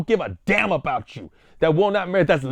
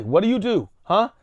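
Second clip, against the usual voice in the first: usual speaking voice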